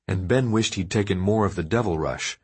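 usual speaking voice

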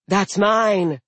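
loud, high defensive claim; giantess claiming. Acted. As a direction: she owns up bluntly. Blode claims meal.

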